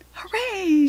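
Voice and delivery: high, loud cub cheer; joyful cub cry; little growly cheer. A narrator's reading. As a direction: cry out in delight as a tiger cub. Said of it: "she cried in her little growly voice".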